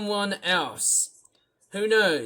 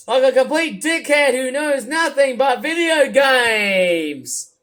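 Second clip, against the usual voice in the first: angry ranting shout; 4 semitones higher, 4 dB louder than usual. Acted. Demanding the raccoon streamer be kicked off Twitch. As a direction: bellow to get him kicked out.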